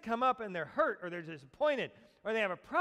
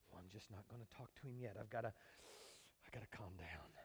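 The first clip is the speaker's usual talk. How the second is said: muttered to self; 12 semitones lower, 16 dB quieter than usual. Acted. Voicing a reluctant believer.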